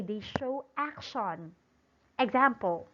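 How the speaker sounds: ordinary talk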